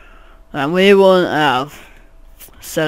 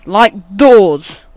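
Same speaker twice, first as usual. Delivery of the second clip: loud; 9 dB louder than usual. Real, not acted. Excited outburst about doors.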